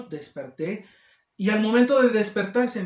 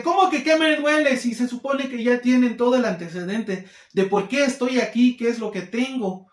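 much higher and louder, incredulous; can't believe she's asking that; fed-up patient snapping back at the nurse, higher and louder; exasperated outburst; 5 semitones higher, 4 dB louder than usual. Retold or imitated.

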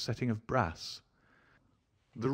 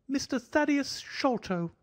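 much higher, slow, twitchy self-introduction; thin high voice. A narrator's reading. Little man introducing himself to his guests, thin and high. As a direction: announce your own name with nervous pride. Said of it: "still jerking and smiling".

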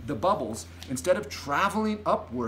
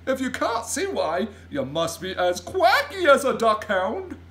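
loud, high, swooping giant tirade; larger-than-life indignant outburst; giant's exasperated cry; 9 semitones higher, 5 dB louder than usual. A narrator's reading. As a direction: scold with comic exasperation and hoots. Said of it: "cried the B F G".

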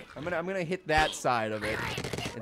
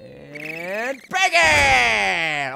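long drawn-out bacon shout, climbing higher; bacon yell; 8 semitones higher, 8 dB louder, much slower than usual. Acted. Gamer yelling a silly catchphrase, drawn-out shout. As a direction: stretch it out and yell it.

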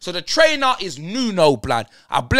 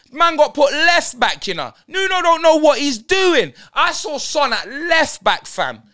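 raised, slowed-down fuming shout; fuming rant; 6 semitones higher than usual. Real, not acted. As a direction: yell it in disbelief at the manager. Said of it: "'cause I'm fuming".

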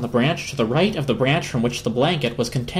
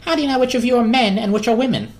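high, fast, demanding squirrel; Harold the squirrel; 9 semitones higher, faster than usual. Acted. Harold demands to know who is male or female, fast and high. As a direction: press the question quickly and high.

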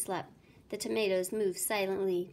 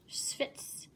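spray sound; 5 dB quieter than usual. A narrator's reading.

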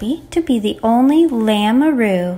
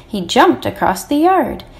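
plain reading voice

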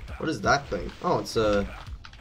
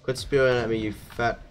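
normal talking voice

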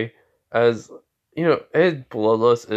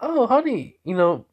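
high-pitched put-on mom voice; mother's voice; 10 semitones higher than usual. Mom greeting her son at home, high motherly voice. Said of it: "She goes".

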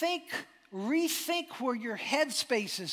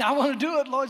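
higher, louder excited cry; eager Peter; 6 semitones higher, 9 dB louder, faster than usual. Acted. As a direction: jump at the chance. Peter pumped, voiced.

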